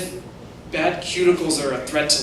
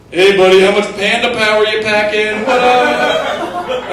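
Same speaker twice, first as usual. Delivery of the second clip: louder, brash bro call-out; loud cocky bro; 5 semitones higher, 7 dB louder, slower than usual. Retold or imitated. Rich guy taunting a Chinese driver, loud and cocky. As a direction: bark it like a showoff in a sports car. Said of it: "he's like".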